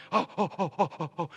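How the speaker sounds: soft, low puffed "oh"s; imitated labor breathing. Husband mimicking his wife in labor, imitated breathing. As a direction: puff rhythmically like a woman in labor. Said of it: "and she's breathing".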